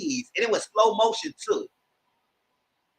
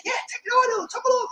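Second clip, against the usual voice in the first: mock high voice. Mimicking Toy egging her on.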